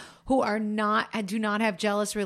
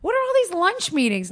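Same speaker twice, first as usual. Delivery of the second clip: high, indignant what the fuck, half joking; mock outraged wife; 10 semitones higher than usual. Wife imitates her reaction to husband's lunch meetings, high and indignant. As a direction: demand answers about the lunches. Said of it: "I'm like".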